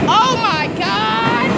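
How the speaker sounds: high, drawn-out oh my God; excited gasp. Real, not acted. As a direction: stretch it out in awe. Sees something on the platform.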